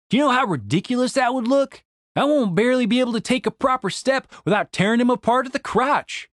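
high, incredulous objection; exasperated Randy. Acted. Narrator voices Randy objecting.

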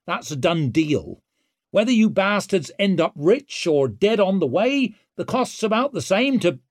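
swaggering rogue taunting; brash young woman. A narrator's reading. Ricker voice.

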